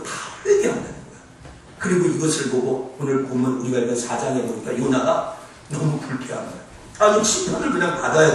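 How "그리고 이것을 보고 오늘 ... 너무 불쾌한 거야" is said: normal talking voice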